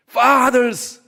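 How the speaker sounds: high, loud summons; calling out fathers. Retold or imitated. Preacher loudly calling out to fathers as scripture does. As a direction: call the fathers to attention.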